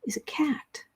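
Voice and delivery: whispered playful reveal; mock secret whisper. Host revealing the cat hint to viewers in a whisper. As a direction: let them in on a secret, hushed.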